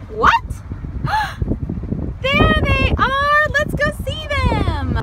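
a big thrilled find for the class; excited shout. Pretend. Finding cows for children.

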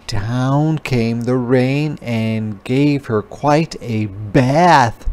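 plain reading voice

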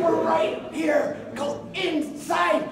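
high, slow, emphatic order; urgent insistence. Retold or imitated. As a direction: stretch each word insistently. Retold urging wife into hospital.

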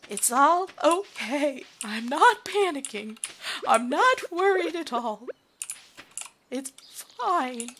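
mock calm. Joking self-reassurance.

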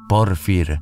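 ordinary delivery

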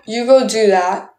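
usual speaking voice

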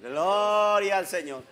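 loud, slow, drawn-out acclamation; called-out praise. Real, not acted. Pastor calling the congregation to praise, exultant call. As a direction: stretch it out high and loud.

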